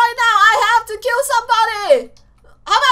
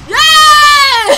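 loud, higher cheer; gleeful shout; 5 semitones higher, 8 dB louder than usual. Real, not acted. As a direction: cheer like you just won. Celebrating kill in game.

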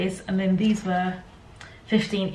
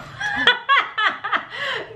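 embarrassed giggle. Real, not acted. Embarrassed at own accent.

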